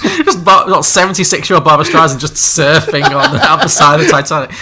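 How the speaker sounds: laughing through the words. Real, not acted. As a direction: talk while cracking up. Laughing at the absurd image.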